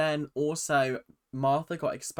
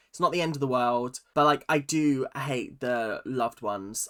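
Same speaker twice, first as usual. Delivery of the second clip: usual speaking voice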